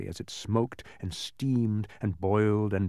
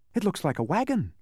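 excited; 7 semitones higher than usual. A narrator's reading. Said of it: "cried Milo excitedly".

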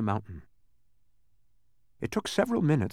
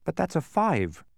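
higher-pitched mild objection; objecting; 6 semitones higher than usual. A narrator's reading. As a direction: object with a touch of puzzlement. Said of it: "objected Milo".

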